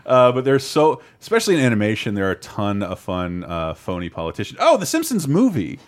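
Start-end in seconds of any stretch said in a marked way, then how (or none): none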